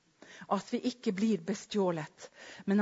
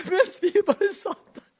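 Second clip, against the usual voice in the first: words squeezed out in high laughter, louder; laughing through words; 10 semitones higher, 8 dB louder than usual. Real, not acted. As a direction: still laughing as you speak. Laughing while telling.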